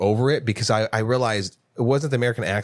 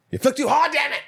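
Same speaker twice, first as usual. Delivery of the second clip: a sudden, much higher, cartoonish yelp of frustration; vocal stim outburst. Real, not acted. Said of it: "Vocal stim of the day".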